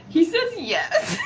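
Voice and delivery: ordinary delivery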